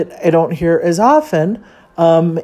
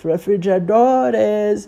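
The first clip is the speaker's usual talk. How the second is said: drawn-out, higher vendor chant; street-vendor tape; 5 semitones higher, slower than usual. Retold or imitated. Imitating the street tape for listeners, drawn-out vendor call. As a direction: stretch it like a recorded street hawker. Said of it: "much worse than that tape".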